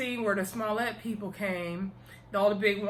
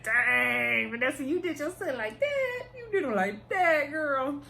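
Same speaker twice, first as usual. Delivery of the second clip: much higher, near crying, in disbelief; shocked outburst; 6 semitones higher than usual. Retold or imitated. Reacting to the mom's cruel line to Dana: shocked, near-crying outburst. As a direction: cry out as if the insult hurt you. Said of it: "I said".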